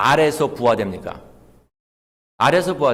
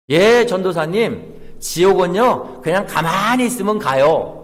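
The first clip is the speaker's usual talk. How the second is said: third-grader answering; 6 semitones higher, 5 dB louder than usual. Retold or imitated. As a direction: imitate an eager pupil, higher and stretched out. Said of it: "초등학교 삼 학년 아이가".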